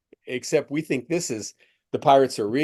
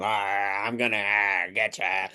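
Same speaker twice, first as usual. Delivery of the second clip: monster voice; 5 semitones lower, slower than usual. Retold or imitated. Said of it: "like that".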